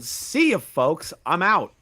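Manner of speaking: big mock reading; mock announcement. Shown as a demonstration. Reading a post title aloud.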